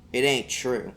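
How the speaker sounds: ordinary delivery, nothing marked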